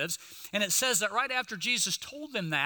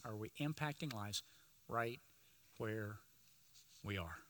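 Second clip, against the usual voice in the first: "Are we impacting lives / right / where / we are?" with a pause before "right", "where" and "we are", softer at the end; dramatic pauses; 9 semitones lower, 14 dB quieter than usual. Shown as a demonstration. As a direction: drop it word by word into the hush. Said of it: "repeated with pauses".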